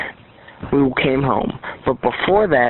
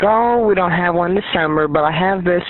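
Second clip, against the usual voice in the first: higher-pitched imitation of her chatting; imitated chatter; 6 semitones higher than usual. Mimics the chatting woman at appointment.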